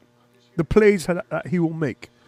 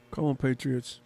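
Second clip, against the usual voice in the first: ordinary talk, nothing marked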